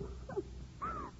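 a high, broken sob in the throat; choked sob. Acted. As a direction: let a sob burst out before speaking. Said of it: "girl, stop crying".

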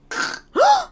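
high, sudden intake of breath; re-enacted shocked gasp; sharp gasp. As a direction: gasp as if your neck just cricked. Acting out the neck cricking.

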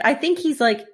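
normal talking voice

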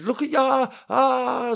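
very high, loud imitation of a shriek; mock scream. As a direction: shriek it like the panicked wife. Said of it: "screaming, shouting".

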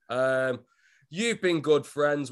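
ordinary talk, nothing marked

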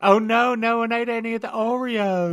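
very high, loud caricature voice; high-pitched mock dismay, easing in volume; cartoon character imitation.

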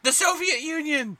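high-pitched blurted quiz answer; mock shouted answer. Co-host imitating friend shouting answers at the gym, high mock shout. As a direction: call it out high, like an over-keen contestant. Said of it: "shouting the answers".

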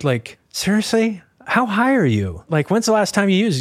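incredulous questioning. Retold or imitated. Officer to suspect about his fake name, incredulous. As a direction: push the disbelief up in pitch.

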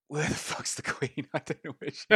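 puzzled creature, game master cracking up; baffled lizardfolk character. Acted. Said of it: "he goes".

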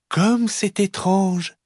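high, slow, sweet wonder; gentle mama bear. Acted. Mama bear puzzled by the fox, gentle slow voice. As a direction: muse slowly as mama bear. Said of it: "dit maman ours de sa voix douce".

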